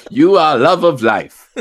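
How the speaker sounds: put-on slow accent sliding toward Borat; slow mock Eastern European accent; Transylvanian accent. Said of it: "Now it's turning into Borat".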